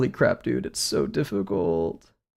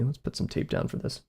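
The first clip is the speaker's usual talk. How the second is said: unvoiced whisper while working; quiet whispered working note; whispered aside; 10 dB quieter than usual. Real, not acted. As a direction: mutter it to yourself with no voice.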